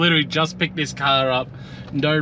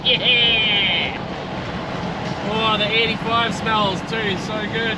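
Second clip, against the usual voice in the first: excited; 10 semitones higher, slower than usual. Real, not acted. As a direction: cheer it out, thrilled. Excited about the car.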